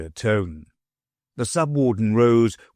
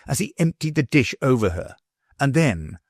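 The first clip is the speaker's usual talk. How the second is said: plain reading voice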